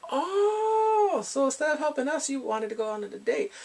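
high-pitched scolding that eases downward; put-on outraged reproach; mock indignation. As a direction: exclaim it high, then settle into a teasing accusation. Voices colleagues confronting him.

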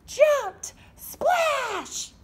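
high, loud burst on the jump; excited jump. A narrator's reading. Storyteller reaching the big jump, high and loud. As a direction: shout the splash with glee.

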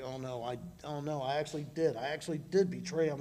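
a touch of tearful regret, understated; mild retold crying. Peter realizing he betrayed Jesus, quietly dismayed. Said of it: "he broke down and cried".

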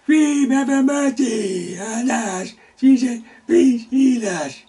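loud, high desperate plea; crying out. Acted. Ten lepers begging Jesus to heal them, a loud high cry. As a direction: beg for mercy at full voice.

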